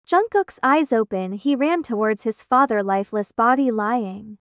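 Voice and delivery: ordinary delivery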